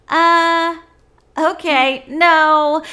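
high, loud, drawn-out balking; mock reluctant refusal. Retold actress balking at the job.

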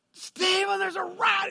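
panicked scream. Retold or imitated. Wife in the pool screaming at her husband, high panicked shriek. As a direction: scream for help at a rat. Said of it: "screaming at the top of her lungs".

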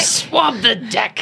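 slow, drawn-out pirate order; playful pirate command. Pretend. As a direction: stretch it out like a hammy pirate. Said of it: "I said I would do pirate".

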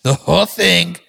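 Donald Trump. Acted. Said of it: "Give him some Donald".

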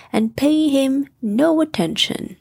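usual speaking voice

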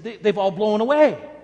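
a panicked kid blurting out the bad news; boy's voice shooting up and loud in alarm; boy's alarmed cry. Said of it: "He said".

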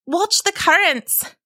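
slow, high warning. A narrator's reading. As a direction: caution him, slowly and urgently. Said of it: "the turtle warned again".

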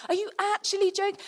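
very high-pitched mock disbelief; acted outrage, voice shooting up; incredulous, hammed-up anger; furious. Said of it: "And so he was furious".

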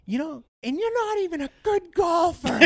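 high, loud taunt dissolving into a laugh; laughing mockery. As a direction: crack up mid-insult. Mocking insult, cracking up.

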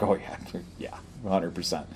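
whispered, laughing under the breath; a hushed chuckling of course; knowing aside. Retold or imitated. Agreeing with the officer about the texting in a whisper. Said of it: "And I'm like".

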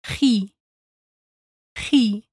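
gi with a throaty rasped g like Spanish j, then a pure i. Shown as a demonstration. Teacher isolating the gi in elegir. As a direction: scrape the g at the back of the throat. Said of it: "the G I sound is".

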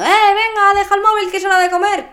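put-on high, loud, fast shout at the kid; mother yelling. Stressed mother yells at teen to drop phone, loud. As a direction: bark it out like a stressed mum. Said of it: "no me está gritando".

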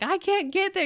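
a touch of desperate wail; mother texting sitter, anguished; retold despair. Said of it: "I'm texting her like".

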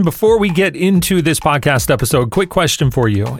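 energetic sponsor-style read over music; promo read. Acted. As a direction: pitch the community like a quick ad spot. Upbeat promo read before episode.

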